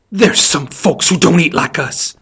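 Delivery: slow, fierce, pressed-out words; fierce reprimand, drawn out slowly; fierce whisper. A narrator's reading. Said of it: "she whispered fiercely".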